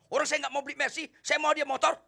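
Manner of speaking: loud, high and fast outburst; angry customer. Acted. Customer losing his temper at the salesman, loud and high. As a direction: lose your temper at the salesman. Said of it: "Anda naik darah".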